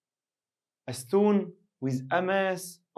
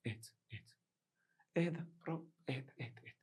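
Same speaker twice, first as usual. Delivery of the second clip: much softer, hushed calming; soothing hush; 3 semitones lower, 13 dB quieter, much faster than usual. Acted. Teacher hushing a panicked student, soft and soothing. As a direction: settle a panicking kid down gently.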